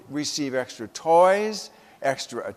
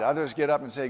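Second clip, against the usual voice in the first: ordinary delivery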